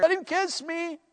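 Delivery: high, excited plea from a smitten woman; eager bride. Acted. Bride longing for her husband in marriage, eager. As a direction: pitch it way up, girlishly eager.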